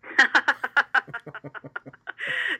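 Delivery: bright rising laugh; real laugh. Real, not acted. Amused by the basket weaving joke, real laughing.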